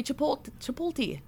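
"Chipotle" as chi-POLE-tee; old people's mispronunciation. Retold or imitated. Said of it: "Old people say".

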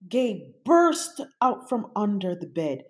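ordinary delivery

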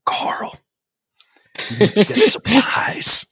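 hoarse urgent whisper-shout; whisper yelling. Acted. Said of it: "my impression of Rick from The Walking Dead".

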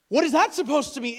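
high, loud mock indignation; offended wife. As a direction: demand an explanation, affronted. Wife challenging husband, acted quarrel.

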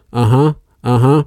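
slow, hesitant polite uh-huhs; polite humoring. Retold or imitated. Polite older daughter humoring the neighbor, slow hesitant uh-huhs. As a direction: humor him without meaning it. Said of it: "the sweet, nice, doesn't wanna hurt anybody's feelings".

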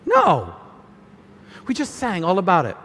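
normal talking voice

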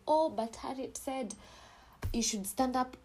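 high mock voice of a fan quoting her; mock follower quoting advice. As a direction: imitate someone tagging her. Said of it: "don't go tagging me saying".

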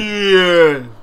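loud, drawn-out slurred boxer's call; Rocky. Retold or imitated. Friends repeating Rocky's call, loud impression. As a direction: holler it like a dazed movie boxer. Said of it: "That's how he talks before he got into boxing".

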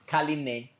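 "Kaline" said the cultural way, kah-LEE-neh; teaching "Kaline" with its native vowels; cultural pronunciation. Shown as a demonstration. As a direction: show how "Kaline" is said in her culture. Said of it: "that's the, the cultural way to say it".

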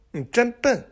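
high, playful jab; teasing taunt. Acted. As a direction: sing it out like a tease. Example taunt quoted.

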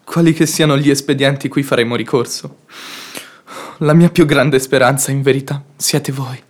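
a lover's quiet avowal after the quarrel; tender confession. Acted. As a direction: say it low and soft to her, meaning every word. Tender declaration after reconciliation.

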